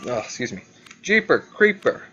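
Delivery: ordinary delivery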